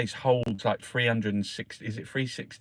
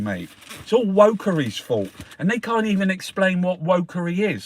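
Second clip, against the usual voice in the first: louder, higher ranting; angry rant; 4 semitones higher, 5 dB louder than usual. Real, not acted. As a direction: rail against the government, fired up. Ranting at the government.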